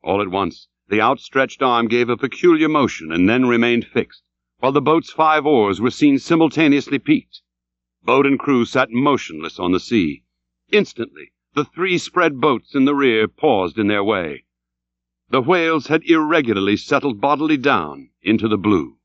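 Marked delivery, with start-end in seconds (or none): none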